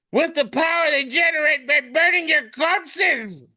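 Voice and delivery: high, strained comic menace; menacing robot punchline. Acted. Robot taunting a dinosaur, mock-menacing high voice. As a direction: push it up high and hammer each word.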